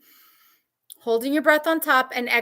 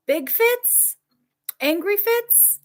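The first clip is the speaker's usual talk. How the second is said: much higher, mock indignant; exaggerated outrage; 9 semitones higher, much slower than usual. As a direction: pile it on, high. Exaggerating partner's fits.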